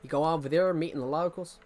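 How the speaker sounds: lightly put-on American accent. Shown as a demonstration. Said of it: "if I can talk in the accent".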